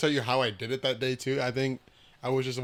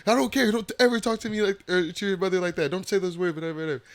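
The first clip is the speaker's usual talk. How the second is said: lecturing dad; 7 semitones higher, 5 dB louder than usual. Retold or imitated.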